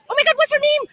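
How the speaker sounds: high-pitched flustered outburst; flustered exclamation. Real, not acted. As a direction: blurt it high, grasping for a name. Blanks on a name, exclaims.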